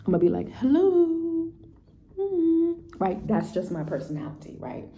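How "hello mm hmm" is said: high, drawn-out mock "hello"; pointed confrontation. Speaker acting out confronting a friend to her face, pointed. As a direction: call them out, high and loud.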